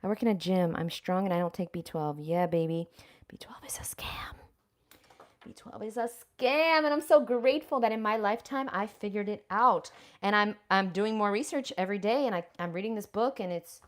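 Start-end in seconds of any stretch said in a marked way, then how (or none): 3.3-4.3 breathy whispered gloat; hushed whisper to the viewers. Acted. Whispered aside to camera. 5.4-9.9 proud declaration. Real, not acted.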